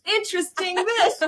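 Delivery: wife mocking husband's calm reply; mocking echo. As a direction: parrot his word back mockingly. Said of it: "I'm like".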